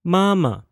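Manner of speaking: mama high and level, then dropping light; first plus neutral tone. Shown as a demonstration. Teacher models mama for repetition, first then neutral tone. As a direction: hold the first syllable of mama high, let the second fall. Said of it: "M A with a first tone".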